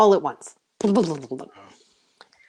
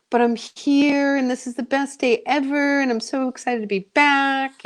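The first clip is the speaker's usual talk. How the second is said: put-on pouting child; 7 semitones higher than usual. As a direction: whine like a disappointed child. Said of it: "so sad".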